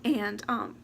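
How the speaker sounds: usual speaking voice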